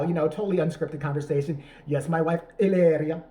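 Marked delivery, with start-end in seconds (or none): 1.9-3.2 mock a husband faking a loud Spanish accent; broad fake Spanish accent, drawn out at the end; bad Mexican accent. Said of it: "he starts talking like that".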